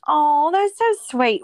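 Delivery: high, loud, drawn-out "aww" reaction; gushing. Real, not acted. Host moved by guest's praise of her brother, gushing. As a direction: gush slowly, pitched well up, touched. Gushes at story of loving brother.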